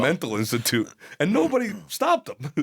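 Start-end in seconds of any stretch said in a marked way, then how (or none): 1.2-2.5 incredulous. Real, not acted. Incredulous punchline.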